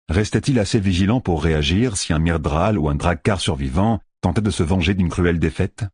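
usual speaking voice

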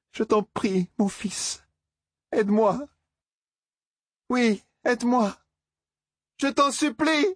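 mother's plea growing to desperation; building plea. Acted. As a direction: rise from a request to a desperate appeal. Mother begging her son.

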